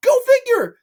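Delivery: very high, loud outburst; sudden comic scream of sarcasm; exasperated shout. Acted. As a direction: yell it way up high in mock amazement. Sarcastic outburst about the plot.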